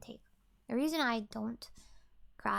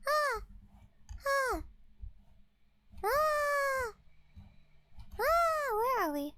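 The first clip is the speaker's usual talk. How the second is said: high yelps; much slower than usual. Pretend. Sudden high yelps, dazed.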